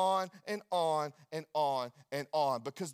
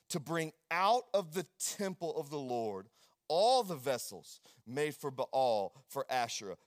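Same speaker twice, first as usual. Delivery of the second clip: scripture reading; 7 semitones lower, 3 dB quieter than usual. Acted. Reads scripture aloud.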